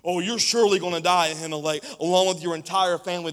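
king's shout. Acted. The king sentences the priest, shouting. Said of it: "the king shouted".

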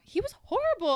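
disgusted. Real, not acted. Disgust at a character.

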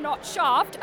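usual speaking voice

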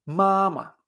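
MAMÁ con primera sílaba alta y larga, segunda corta; tono uno neutro. Shown as a demonstration. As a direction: alarga el primer MA y suelta el segundo. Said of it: "uno cinco o uno y sin tono". («mamá» en chino: alto y luego neutro)

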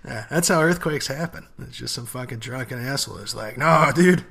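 ordinary delivery

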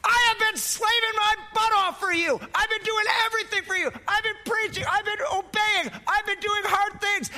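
high, complaining voice, sorry for himself; whiny Elijah. Acted. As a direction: list his grievances in a high, aggrieved voice.

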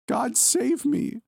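put-on very high, slow, terrified child's cry; a little girl crying out for her life. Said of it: "a child cried on the line".

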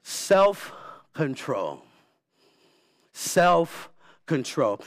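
self-control drawn out very slowly; slow emphasis. Shown as a demonstration. Preacher waking up the congregation, slow emphasis. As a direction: let "Self-control." sink in, word by word. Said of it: "wake up for this one".

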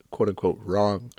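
ordinary delivery

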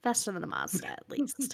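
soft unvoiced whisper. Real, not acted. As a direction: whisper it as an aside. Whispered joke aside.

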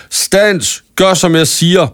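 high, sharp commanding cry; shouting a command. Acted. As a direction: stop them with a shouted order. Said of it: "råbte Cornelia".

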